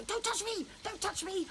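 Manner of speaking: high, sharp "Don't touch me" twice, pushing someone away; angry refusal. Retold or imitated. Therapist voicing an angry child refusing touch, sharp and high. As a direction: imitate an agitated person snapping at a carer, pitched high. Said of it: "really angry and they go".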